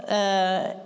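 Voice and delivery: usual speaking voice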